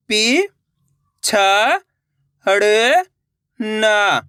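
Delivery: पि, छ, हड़, ना drawn out with gaps; syllable by syllable. Shown as a demonstration. Teacher breaking a word for learners, syllable by syllable. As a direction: sound out पि, छ, हड़, ना singly. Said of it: "एक अक्षर का उच्चारण करके".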